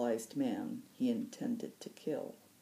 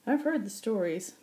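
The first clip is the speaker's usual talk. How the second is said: put-on old pilot voice; 8 semitones higher, 5 dB louder than usual. Occam voiced.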